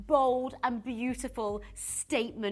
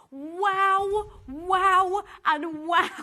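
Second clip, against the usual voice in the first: loud, higher, building wows; delighted wows; 6 semitones higher, 9 dB louder, much slower than usual. Real, not acted. TV host to viewers, loud delighted wows. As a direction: gush over it, each wow bigger.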